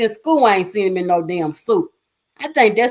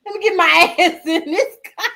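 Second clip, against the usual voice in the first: laughing; 9 semitones higher than usual. Retold or imitated. Asking son Tay for the car keys, laughing. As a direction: beg for the keys through laughter. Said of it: "I said".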